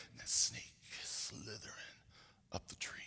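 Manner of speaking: hushed storyteller whisper; suspense whisper. A narrator's reading. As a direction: drop to a whisper as the snake climbs. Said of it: "suspense in the story".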